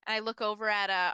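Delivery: usual speaking voice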